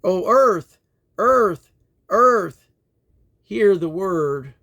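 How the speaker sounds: loud, high cry, each earth rising. Acted. Reader calling on the earth, loud high proclamation. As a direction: call out to the earth, slowing on hear the word.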